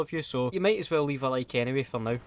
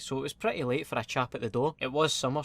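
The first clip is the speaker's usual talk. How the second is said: ordinary talk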